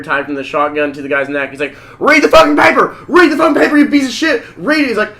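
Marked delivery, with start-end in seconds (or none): 2.0-4.9 high, loud enraged bark of orders; furious shout. Retold or imitated. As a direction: yell it at your hostage, far higher and louder. Acting gunman berating hostage on TV.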